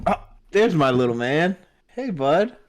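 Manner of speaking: sweet voice for a little son. Real, not acted. Father greets toddler son.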